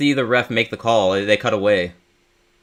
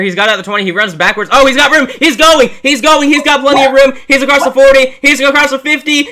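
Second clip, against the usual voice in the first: fast breathless return call, much higher and louder; live play-by-play; 11 semitones higher, 8 dB louder than usual. Real, not acted. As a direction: build the call as he breaks free. Calls punt return live.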